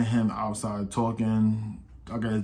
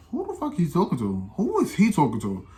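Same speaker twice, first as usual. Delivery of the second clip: higher-pitched incredulous question; retold indignation; 5 semitones higher than usual. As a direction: act out being offended, pitched up. Retelling their indignant reply to Jaden.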